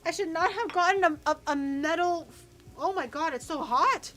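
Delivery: high, loud yelps of shock; real pained alarm. Real, not acted. Cook burned by a metal container, loud shocked exclaiming. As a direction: cry out as if you just touched scalding metal.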